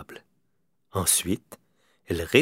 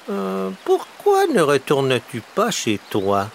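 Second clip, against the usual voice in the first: high, slow crow voice; crow asking; 10 semitones higher, 3 dB louder than usual. A narrator's reading. Crow asking the little bear, high and slow. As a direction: ask it up high and drawn out like the crow. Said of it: "demanda Corneille".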